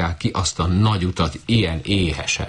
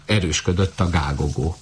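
ordinary delivery, nothing marked

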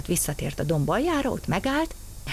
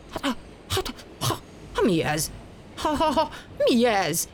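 startled engineer; 7 semitones higher than usual. A narrator's reading. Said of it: "kiabált a mozdonyvezető".

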